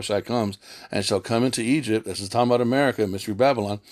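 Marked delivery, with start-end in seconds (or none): none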